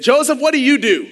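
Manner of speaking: high, loud imitation of her asking; loud retold question. Ann asking the speaker his job, loud imitated question. Waitress-side diner acquaintance calls question.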